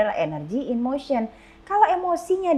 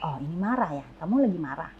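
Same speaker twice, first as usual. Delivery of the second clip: calm soothing mother; 6 semitones lower, 5 dB quieter than usual. Retold or imitated. Mother calmly naming child's anger.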